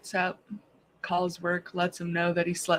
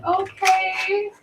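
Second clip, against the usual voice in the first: high, drawn-out dubious reply; stretched-out high mock hesitation; 11 semitones higher, 3 dB louder than usual. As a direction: imitate an awkward, unconvinced coworker. Coworker's awkward reply, acted.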